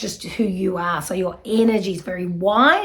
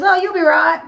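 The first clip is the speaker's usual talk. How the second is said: put-on higher, louder childlike reassurance; little boy's reassurance; 10 semitones higher, 6 dB louder than usual. Little son reassuring his crying mum, bright and high. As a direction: chirp it like a small boy.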